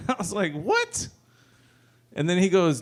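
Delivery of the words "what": high, startled squeak of "What?"; shocked what. Retold or imitated. Dad caught by his son, shocked high what. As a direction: caught off guard, voice jumps up.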